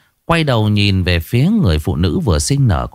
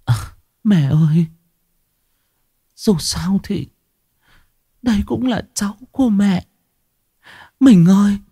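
weak pleading; 11 semitones higher than usual. Acted. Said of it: "cô ta thều thào".

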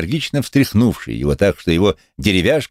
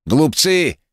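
high-pitched scornful cry; 11 semitones higher than usual. A narrator's reading. Wooden-legged man turns on the crowd, crying out. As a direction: fling it out at the crowd. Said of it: "выкрикнул человек с деревянной ногой".

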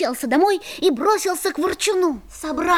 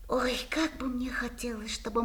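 whispered wish; 3 semitones lower, 12 dB quieter than usual. Acted. Boy confides his secret wish.

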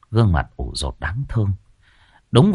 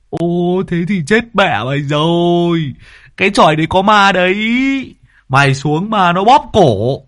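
high, loud, fast scary tale voice; spooking friend; 5 semitones higher, 3 dB louder than usual. Acted. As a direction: frighten him with ghost talk. Toàn Thọt dọa có ma. (giọng cao, to, dọa ma)

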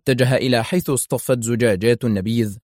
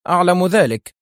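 higher, louder flippant reply; careless; 8 semitones higher, 5 dB louder than usual. A narrator's reading. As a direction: brush it off with breezy unconcern. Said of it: "في استهتار وهو يقول". (استهتار بنبرة عالية)

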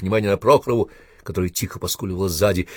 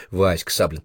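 plain reading voice

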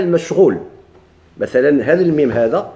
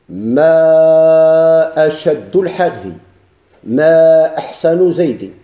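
each word drawn out very slowly and louder; slow example; 6 dB louder, much slower than usual. Shown as a demonstration. Teacher to students, stretching the example sentence slowly. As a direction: spell out the example phrase word by word. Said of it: "نقول مثلًا".

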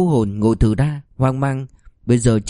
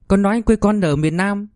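put-on high child's voice, earnest; a child reciting an answer to mom; little boy answering; 8 semitones higher than usual. Child gives wrong answer.

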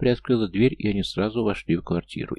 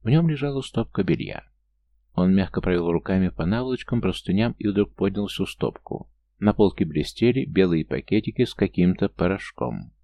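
ordinary delivery, nothing marked

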